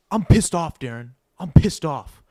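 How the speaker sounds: loud imitation of the coach yelling; retold screaming. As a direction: bark it out like the angry coach. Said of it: "he starts screaming at me".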